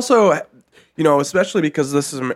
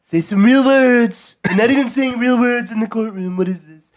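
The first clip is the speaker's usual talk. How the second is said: annoyed heckler; 8 semitones higher than usual. Acted. Voicing an annoyed modern heckler.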